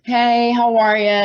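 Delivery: lightly put-on playful greeting; playful bar greeting. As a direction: greet customers playfully, stretching it out. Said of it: "just playing the game, right?".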